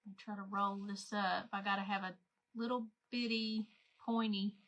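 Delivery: speaking while holding breath; squeezed out on a held breath. Real, not acted. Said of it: "I'm holding my breath".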